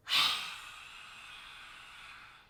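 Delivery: breathy, voiceless lion roar; long exhaled roar with the tongue out. Shown as a demonstration. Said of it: "we are going to roar".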